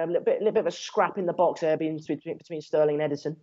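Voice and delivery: ordinary delivery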